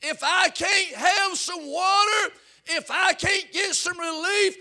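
high, loud, slow desperate shout; rich man crying out. Acted. Rich man in hell begging Abraham to warn family, anguished shouting. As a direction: scream the warning to his brothers. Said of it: "He cried out".

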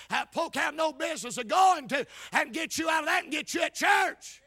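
very loud, high-pitched preaching shout; hollering preacher. Real, not acted. Preacher hollering that Jesus saves sinners, full-force shout. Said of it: "all that hollering".

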